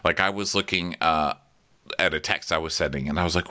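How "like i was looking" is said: ordinary talk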